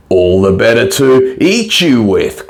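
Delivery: a hammed-up storybook wolf pounce; mild menacing cry before springing; cried out. A narrator's reading. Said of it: "cried the wolf".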